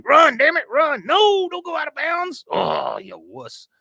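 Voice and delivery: high, loud sports-fan yell, dropping to a slow groan. Retold or imitated. Willie watches the game, yelling at the TV. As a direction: yell like an exasperated football fan, then sag. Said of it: "he's there yelling at the T V".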